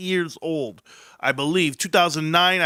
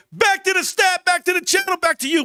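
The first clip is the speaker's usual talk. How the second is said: excited; 12 semitones higher than usual. Real, not acted. Swinging back to his own show.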